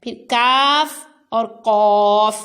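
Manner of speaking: کاف light, قوف full and thick, said slowly; teacher contrasting کاف and قوف; thin kaf, heavy qaf. Shown as a demonstration. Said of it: "کاف باریک پڑھنا ہے اور قوف کو ہر حالت میں پر".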